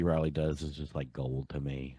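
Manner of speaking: ordinary talk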